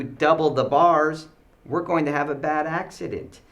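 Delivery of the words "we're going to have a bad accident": ordinary talk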